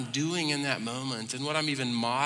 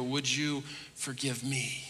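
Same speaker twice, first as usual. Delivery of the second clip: humble apology; 8 dB quieter, slower than usual. Retold or imitated. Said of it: "one of the family members ... and go".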